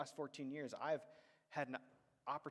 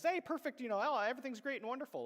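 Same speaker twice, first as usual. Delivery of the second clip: much higher, louder sarcastic sing-song; mock cheery; 11 semitones higher, 8 dB louder than usual. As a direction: sell it as fake sunshine, fast and gushing. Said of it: "It wasn't like this".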